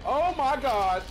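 sudden loud shocked gasp; panicking gamer. Real, not acted. Cook ambush in horror game.

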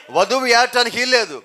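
usual speaking voice

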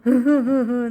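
loud chuckle in a run; laugh. Real, not acted. Amused reaction. (yüksek sesli kahkaha)

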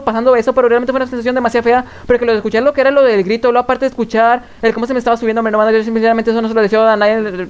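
hurried, run-on storytelling; speed-talking. Shown as a demonstration. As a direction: gabble through it at top speed. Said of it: "Voy a intentar hablar demasiado rápido".

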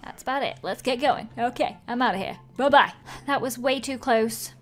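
usual speaking voice

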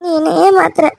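high, crying accusation; tearful. Acted. Girlfriend who saw him carry another woman, tearful. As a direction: sob out the accusation, feeling cheated. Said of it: "எதுக்கு இப்படி அழுதுட்டு இருக்க".

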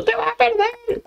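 tiny high squeaky mocking voice; very high put-on naysayer voice; imagined heckling viewer. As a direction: mimic the viewer in a piping falsetto. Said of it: "ya sé que ahora mismo estás pensando".

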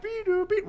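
very high electronic beeps; imitated warning beeps. Preacher voicing warning signs, imitated beeps. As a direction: mimic an alarm signal beeping.

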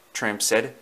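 ordinary delivery, nothing marked

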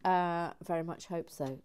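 usual speaking voice

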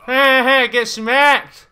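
high, loud fight taunt; taunting yell. Real, not acted. Gamer hitting an opponent, taunting yell. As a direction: yell it at the enemy.

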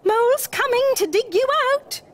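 high, loud rescuer's call; high, heroic little Mole; urgent cry. A narrator's reading. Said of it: "he cried".

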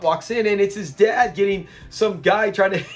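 usual speaking voice

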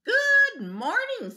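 very high, slow, sing-song greeting; friendly ladybug. Acted. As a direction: chirp a sweet hello. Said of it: "said the friendly ladybug".

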